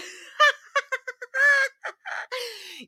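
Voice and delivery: a long, high, loud laugh; high cackle of laughter. Real, not acted. Laughing at her own clip, long high laugh. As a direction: let the laugh run out.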